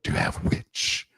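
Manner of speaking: low rumbling dragon; deep dragon voice. Acted. Dragon asking the witch for a meal, low growl. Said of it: "most of what you were saying".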